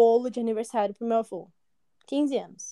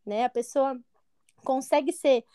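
normal talking voice

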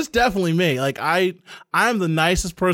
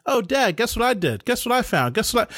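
put-on higher, sing-song little girl pestering dad; imitating daughter; 6 semitones higher than usual. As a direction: lift the voice to mimic a child sucking up. Daughter voiced pestering her dad.

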